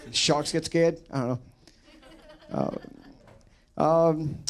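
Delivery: usual speaking voice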